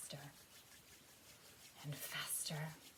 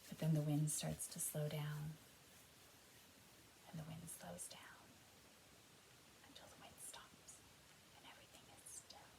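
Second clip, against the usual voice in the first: soft voice fading to a whisper; fading to stillness; 9 dB quieter than usual. Pretend. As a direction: let the wind die away to nothing. Everything is still.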